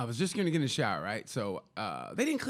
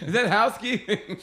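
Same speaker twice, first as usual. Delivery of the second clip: high, loud shout through the door; loud nervous call from the shower; mock panicked yell; 8 semitones higher, 6 dB louder than usual. Continuing the door scene.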